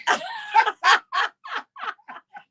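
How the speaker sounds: long, high giggling laugh; helpless high laughter; burst of laughter. Real, not acted. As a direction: crack up at a silly joke. Breakfast joke lands.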